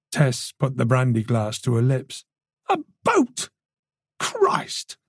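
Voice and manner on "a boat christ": very high, aghast outburst; aghast, voice shooting up; shocked disbelief. A narrator's reading. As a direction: repeat it in horrified disbelief. Shocked at suspect's escape.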